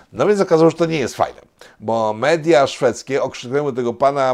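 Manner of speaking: ordinary delivery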